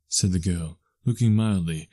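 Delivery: ordinary delivery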